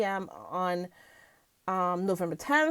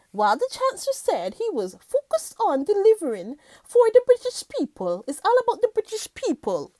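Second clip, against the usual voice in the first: put-on British accent; 9 semitones higher than usual. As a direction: play the Queen with a hammed-up British accent. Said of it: "trying to do this British accent".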